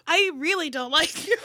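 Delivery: high, loud, laughing retelling; laughing mock insult. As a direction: crack up while imitating his rejection. Retells his doubled-down message, amused.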